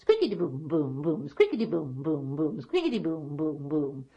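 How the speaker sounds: rhythmic squeak, then dropping booms; weaving loom noise. Acted. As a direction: imitate a shuttle clacking back and forth. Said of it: "it sounded like this".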